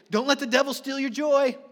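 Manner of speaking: hammed-up church cheerleading; mock encouragement. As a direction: chirp it like an unhelpful well-wisher. Mimics Christians' upbeat platitudes.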